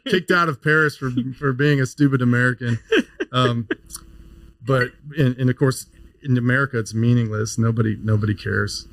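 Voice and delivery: usual speaking voice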